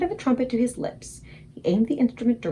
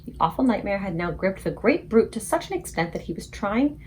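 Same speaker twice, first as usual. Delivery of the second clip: ordinary delivery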